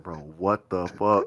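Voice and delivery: low, slow and hushed; quiet, drawn-out retold astonishment; stoned amazement. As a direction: stretch it out softly in dazed disbelief. Retelling his stoned amazement at himself.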